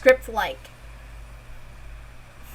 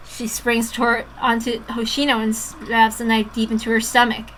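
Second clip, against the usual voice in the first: usual speaking voice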